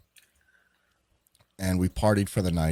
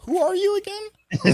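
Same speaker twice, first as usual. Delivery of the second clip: very high, strained mock-confused squeak; baffled question; slower than usual. Storyteller asks the mystery caller who he is, baffled falsetto. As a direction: ask it in a drawn-out falsetto.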